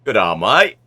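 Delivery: a cheery Aussie greeting, loud; Australian "G'day, mate" with a rising "mate"; Aussie accent. Shown as a demonstration. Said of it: "I love the Aussie accent".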